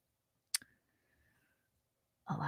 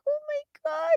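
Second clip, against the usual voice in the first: high, soft gasping "oh my god"; gushing viewer; 5 dB quieter than usual. Retold or imitated. A viewer reacting to the little girl, high-pitched gush. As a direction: squeal it like a moved fan. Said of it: "and you're like".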